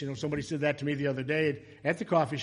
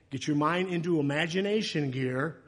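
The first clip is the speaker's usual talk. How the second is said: normal talking voice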